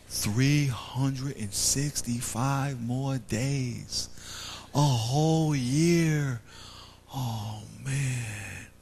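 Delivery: slow, soft, letdown sigh; sighing disappointed kid. Retold or imitated. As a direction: drag it out like a let-down kid. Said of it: "as a little kid".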